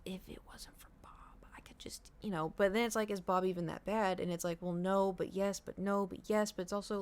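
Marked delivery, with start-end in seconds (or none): 0.0-2.1 hushed, frustrated whisper. Real, not acted. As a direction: whisper it under your breath. Venting about neighbour under breath.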